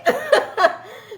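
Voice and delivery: a burst of real high laughter; breaking into a high giggle. Real, not acted. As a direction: laugh out at how absurd it was. Laughing at the note.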